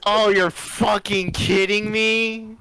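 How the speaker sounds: drawn-out high exasperated cry; sing-song disbelieving groan, slowly; exasperated disbelief. Real, not acted. Mock outrage at correction.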